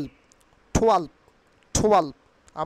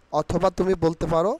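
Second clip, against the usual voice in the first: usual speaking voice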